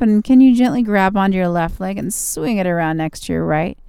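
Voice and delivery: calm and soothing, easing into rest. Acted. Yoga relaxation cool-down.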